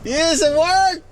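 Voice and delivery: slow, high, loud real cheer; excited cheer. Real, not acted. Celebrating the CB radio working, slow high cheer. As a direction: savor the win, stretching it out.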